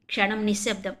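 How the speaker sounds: ordinary delivery, nothing marked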